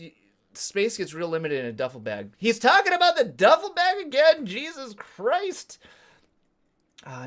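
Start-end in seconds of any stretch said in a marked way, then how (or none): 2.4-5.8 much higher, hammed-up complaining voice; mock exasperation. Mimicking a viewer sick of duffel bag talk, mock exasperated.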